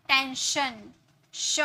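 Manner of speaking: ordinary talk, nothing marked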